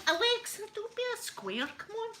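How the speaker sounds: high-pitched wheedling at a smart speaker; mock exasperated coaxing, high; pleading cajoling. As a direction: coax her, voice pitched right up. Coaxing Alexa in retold argument.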